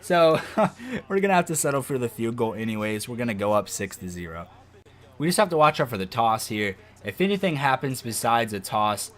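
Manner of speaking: normal talking voice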